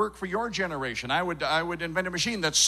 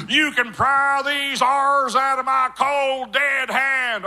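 high, slow, defiant Texas drawl; Texas accent; 7 semitones higher, 4 dB louder, slower than usual. Acted. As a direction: declare it defiantly as a Texan. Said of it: "the Texas state border sign".

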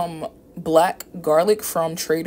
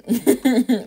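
short, high chuckle; light laugh to herself; amused chuckle; 8 semitones higher, 5 dB louder than usual. Real, not acted. Vlogger chuckling over getting her hands messy, amused.

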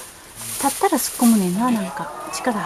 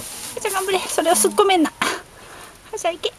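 high-pitched, unforced cheer; urging on; 7 semitones higher, 3 dB quieter than usual. Real, not acted. Urging Haru-chan to push, high cheering. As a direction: coax her along in a high voice. Cheering on the animal giving birth.

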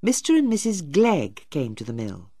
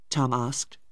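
plain reading voice

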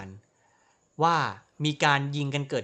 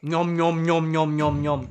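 munching noises, nom nom; mock chomping; 4 dB louder than usual. Gamer mimics his character eating, chomping noises. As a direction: chew loudly like a cartoon.

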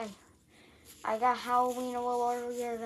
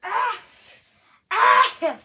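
high frustrated cry; sharp cry of frustration; frustrated yelp; 11 semitones higher, 14 dB louder than usual. Real, not acted. Struggling to put costume on.

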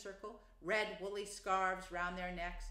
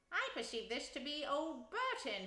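higher, appreciative remark; mildly pleased connoisseur rat; approving; 6 semitones higher than usual. A narrator's reading. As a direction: inspect it and approve. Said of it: "he remarked approvingly".

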